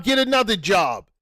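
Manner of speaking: ordinary talk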